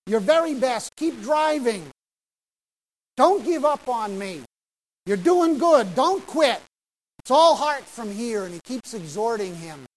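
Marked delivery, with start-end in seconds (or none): 0.1-8.4 loud, higher sideline shouting; yelled encouragement. Retold or imitated. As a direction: drive him on with every shout. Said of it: "he continues to yell encouragement".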